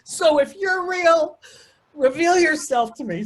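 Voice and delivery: high, loud, tearful call upward; shouting up at the sky, voice cracking; retold scream to the sky. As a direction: cry out to God, half reliving it. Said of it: "I'm screaming up to the sky".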